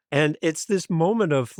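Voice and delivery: ordinary talk, nothing marked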